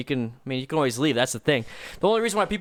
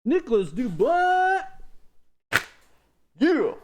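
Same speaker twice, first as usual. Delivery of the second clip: excited shouts; much slower than usual. Real, not acted. Hyped shouts on livestream.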